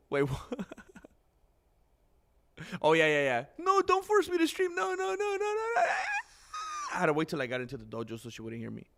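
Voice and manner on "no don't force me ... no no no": high, mock shouted refusal, much higher; imitating Grandmaster. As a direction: whine no over and over like her. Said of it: "Grandmaster said".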